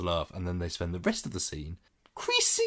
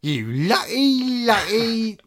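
quoting the film; 10 semitones higher, 4 dB louder, much slower than usual. Retold or imitated. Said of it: "turns of phrase that I use".

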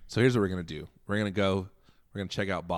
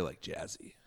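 whispering; 7 semitones lower, 16 dB quieter than usual. Real, not acted. Soft aside about the bass.

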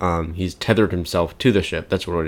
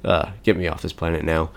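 scoffing aside; 3 semitones lower, 7 dB quieter, faster than usual. Retold or imitated. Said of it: "And Nebula scoffs".

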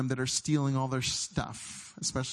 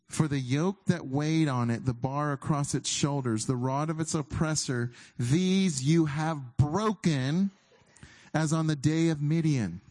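scripture reading; 2 semitones higher, 3 dB louder than usual. Acted. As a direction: let it swell to a triumphant climax.

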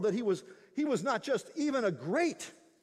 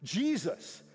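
louder, rising proclamation from the pulpit; full-force preaching; 4 semitones higher, 4 dB louder than usual. Acted. Pastor proclaiming Jesus as Savior to the congregation, loud and fervent. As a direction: preach it out with building conviction.

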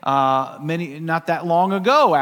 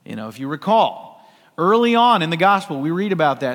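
usual speaking voice

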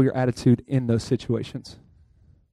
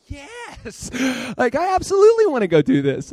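mock eager yes; 8 semitones higher than usual.

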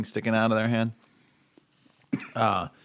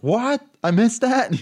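surprised; 13 semitones higher, 6 dB louder, slower than usual. Retold or imitated. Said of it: "I wasn't like".

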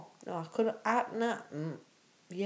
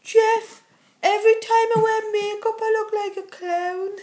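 character impression; 8 semitones higher, 4 dB louder than usual. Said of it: "impersonating a character".

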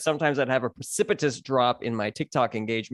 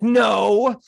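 loud, much higher barked no; aggressive no; 7 semitones higher, 7 dB louder than usual. Retold or imitated. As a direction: snap no at them aggressively. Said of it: "very aggressively like that".

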